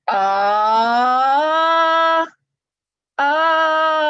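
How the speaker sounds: rising vocal glide on a held ah; pitch glide on ah. Shown as a demonstration. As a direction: move the larynx up on the ah, then stop. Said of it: "Here's my demonstration".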